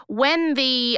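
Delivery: ordinary delivery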